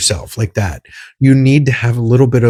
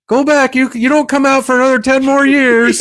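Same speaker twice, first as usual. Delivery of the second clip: high-pitched cartoon imitation; movie characters' scolding; 13 semitones higher than usual. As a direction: send someone away in a put-on high voice. Retold movie characters sending one back.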